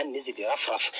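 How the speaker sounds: ordinary delivery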